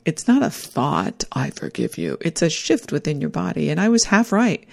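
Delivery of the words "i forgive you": unvoiced, much lower whisper. Quoting the forgiveness she felt in a soft whisper. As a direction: breathe it out as a whispered thought.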